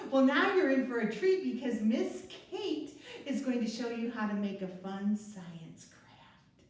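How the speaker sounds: usual speaking voice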